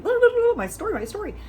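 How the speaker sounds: high, loud mocking blah blah; mock chatter. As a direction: parody your own nonstop chatting. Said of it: "I'm all chatty telling the story".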